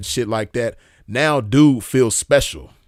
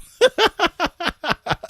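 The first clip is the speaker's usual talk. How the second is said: high-pitched cackling laugh; real, rapid high laugh; laughter. Real, not acted. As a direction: crack up in a long high giggle. Cracks up after singing.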